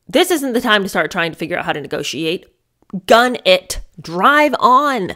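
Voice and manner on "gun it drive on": loud, urgent command to floor it; urgent pretend shout. Yelling at Allie from the passenger seat to drive, loud and urgent. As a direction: yell at her to gun the engine.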